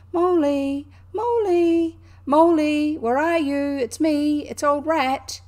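loud, high, cheerful shouts into the wood; cheerful calling. A narrator's reading. Rat searching the dark wood for Mole, cheerful loud calling. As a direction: call out brightly so your friend can hear. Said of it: "calling out cheerfully".

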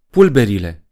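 loud, sharp order; roared command. A narrator's reading. Poe ordering his forces against the rocket, loud roar. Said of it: "răcni el".

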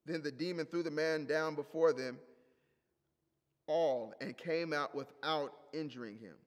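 normal talking voice

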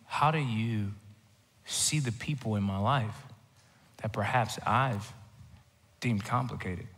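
whispered start, then soft, slow prayer; hushed prayer. Acted. Preacher modelling a prayer for complicated parents, hushed and soft. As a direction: pray it gently, as if alone with God.

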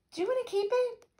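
high, small and soft; girl's voice. A narrator's reading. Annie handing back to Dipper, high soft girl's voice. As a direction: offer it in a high little voice.

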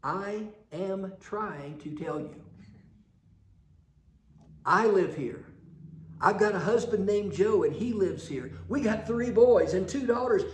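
loud, slow-then-pushy persnickety lady; insist, a little huffy. Retold or imitated. Lady getting persnickety with the census man, loud and huffy. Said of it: "she got a little persnickety".

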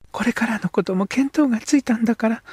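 put-on high young girl's voice, hopeful; これからのこと said by a high-voiced girl. Girl character speaks.

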